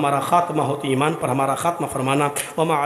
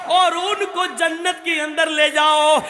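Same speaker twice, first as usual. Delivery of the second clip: raised, fervent sermon storytelling voice; impassioned preaching; 12 semitones higher than usual. Acted. Preacher telling the congregation of children in paradise, fervent preaching. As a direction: preach it with rising fervour to the crowd.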